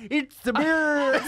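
high, drawn-out parody of an American; bad American accent. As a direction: imitate the awful American take, slow and high. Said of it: "as an American".

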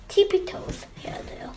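high, unvoiced tiptoe whisper; sneaky whisper. A narrator's reading. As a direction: whisper it as if creeping. Girls creep quietly.

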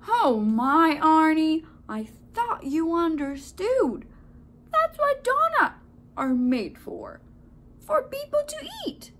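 loud, rising high baker voice; baker explaining. A narrator's reading. Baker explains donuts' fate to Arnie, loud surprise.

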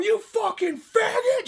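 high shouted rage, slowed, half laughing; savage scream. Retold or imitated. Trevor attacking the biker, high furious laughing scream.

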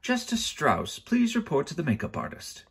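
high-pitched backstage summons; calling out. A narrator's reading. Crew member sending the judge to makeup, calling out high. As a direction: call it like an announcement. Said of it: "a voice called".

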